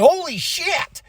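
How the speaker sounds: high, drawn-out amazement; retold jaw-dropped shock; stunned reaction. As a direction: stretch it out high in disbelief. His stunned reaction to the grab.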